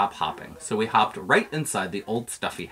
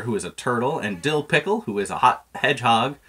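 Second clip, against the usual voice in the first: plain reading voice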